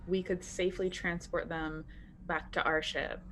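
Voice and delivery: normal talking voice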